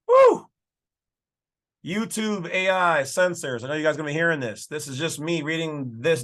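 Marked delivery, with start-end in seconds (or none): 0.1-0.5 loud, very high "woo"; high whoop. Real, not acted. Reader thrilled by the Declaration line, high loud whoop.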